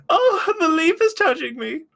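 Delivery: very high, loud mock shriek; hammed-up horrified old-lady voice; prude old lady. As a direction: squeal it like a scandalised old lady. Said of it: "some prude old lady being like".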